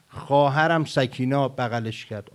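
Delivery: ordinary delivery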